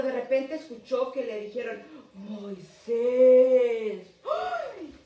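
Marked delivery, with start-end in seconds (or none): 2.1-4.0 loud, solemn call of the name; voice of God calling. Retold or imitated. God calls Moses from the burning bush, a loud solemn call. 4.2-4.9 sudden very high startled yelp; startled cry. Retold or imitated. Moses hears God speak from nowhere, a high startled yelp.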